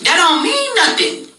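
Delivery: heated retort. Retold or imitated. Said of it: "I said".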